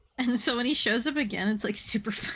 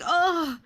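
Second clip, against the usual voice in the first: mock pained grunt; 8 semitones higher, 3 dB louder than usual. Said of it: "He's like".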